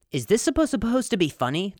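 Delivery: high, indignant mock reading; mock commenter. Host reads the hater's comment in a mock indignant voice. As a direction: read it as a huffy commenter. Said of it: "this was the comment".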